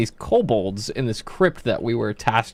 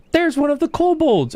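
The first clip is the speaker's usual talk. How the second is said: high, eager shout of discovery; excited fighter callout; 12 semitones higher than usual. Retold or imitated. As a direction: point out the enemy, pumped. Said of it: "our fighter is like".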